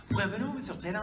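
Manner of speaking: high, lilting Quebecois French welcome. Retold or imitated. Handler welcoming a guest to Montreal, lilting Quebecois French. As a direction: imitate a Quebecois handler's greeting. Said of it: "she had this gorgeous Quebecois accent".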